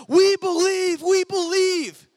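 high, loud chanted cheer; crowd cheering. Retold or imitated. Crowd roaring back at the tightrope walker, loud high chant. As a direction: yell it like a thrilled crowd.

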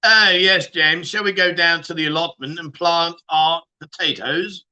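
mock posh English; posh accent. Streamer inviting James to the allotment, mock posh accent. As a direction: put on plummy upper-class English. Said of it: "Posh accent".

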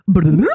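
bloop rewind sound effect; tape rewinding. Shown as a demonstration. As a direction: make a bubbly rewind noise. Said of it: "rewind noise again".

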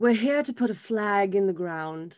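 lightly done fancy British read; a hint of upper-class British; posh British accent. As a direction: read the bottle like a rich Brit. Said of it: "I like to do British".

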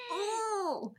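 high, soft swooning coo. Acted. Reader reacts to Ryan's heartfelt line, softly swooning. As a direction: melt into a high, small "oh".

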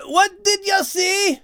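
high, slow shout over blocked ears. Acted. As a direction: shout as if half-deaf at altitude. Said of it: "shouted Max to Kate".

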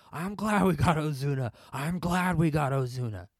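hammed-up triumphant holler; gloating outburst. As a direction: shout it out like a long bottled-up boast. Acted gloating outburst at the women.

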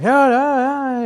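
high, loud put-on no, no, no; mock chorus pitched high and loud; band's reply. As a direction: answer like the whole band at once. Band voiced answering.

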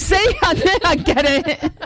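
high, drawn-out words through real laughter; laughing in triumph. Real, not acted. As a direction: crow it through a big laugh. Laughs in triumph at remembering title.

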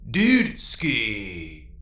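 drawn-out robot dude voice; robot echo. A narrator's reading. Robot echoing its thrilled owner, drawn-out robotic dude voice. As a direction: echo it back as a bro android. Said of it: "the robot echoed".

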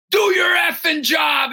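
high, loud yelled demand; angry homeless man yell. Retold or imitated. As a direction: bark it at the driver like a furious stranger. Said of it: "He goes".

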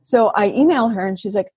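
ordinary delivery

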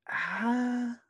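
normal talking voice

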